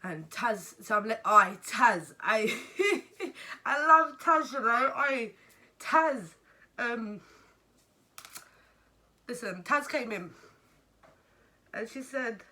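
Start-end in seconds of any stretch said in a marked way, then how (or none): 1.3-6.3 loud, laughing affection; fond excited shout-out. Real, not acted. Mother remembering a favourite worker, loud laughing fondness. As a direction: burst out with a big, high shout-out. Calls fondly to Taz, laughing.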